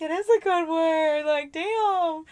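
high and loud, impressed; playing up delight at a word; mock awed. Retold own delighted reaction.

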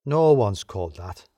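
low, slow insistence; insistent. A narrator's reading. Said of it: "insisted Lem".

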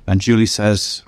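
ordinary talk

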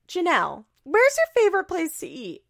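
high, bright mock host voice; hammed-up chirpy question; mock interviewer question. Asking Janelle her favorite restaurant, mock chirpy. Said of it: "and Marissa goes".

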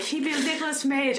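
punchline pitched high and odd; weird high punchline. Retold or imitated. Mimicking the friend's punchline, oddly high. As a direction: deliver it in a strange lifted voice. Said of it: "she said it in the weirdest way".

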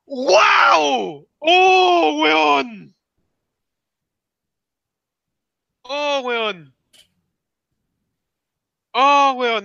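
ecstatic shouts of disbelief; excited shouting. Real, not acted. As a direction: yell out in pure hype. Hyped reaction to Smash reveal.

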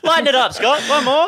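high-pitched, lightly hammed-up direction; mock film director. Panellist playing director asking Scott for another take, jokey high-pitched encouragement. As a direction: call out a retake through amusement.

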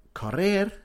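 "Correr" with a strong trilled rr, high and loud; "Correr" con la erre bien vibrada; rolled R. Shown as a demonstration. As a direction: roll the R hard in "Correr". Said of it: "that rolling of the R's".

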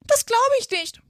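very high, loud retort; disbelieving pigeon. A narrator's reading. Pigeon rejecting Alice's claim, disbelieving. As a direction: refuse to believe it in a screechy bird voice.

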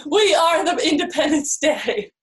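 high, playful movie-hero declaration; mock-dramatic proclamation. Joking with partner about being aliens, mock-dramatic proclamation. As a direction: proclaim it like a blockbuster line.

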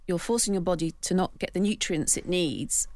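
usual speaking voice

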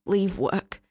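plain reading voice

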